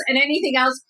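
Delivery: ordinary delivery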